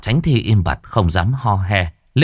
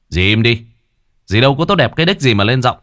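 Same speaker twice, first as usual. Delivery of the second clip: louder, fast stern warning; hard-voiced warning; 4 semitones higher, 4 dB louder, faster than usual. Brother warns the stepmother, hard and louder. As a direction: press each word hard at her. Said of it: "Tấn gằn giọng".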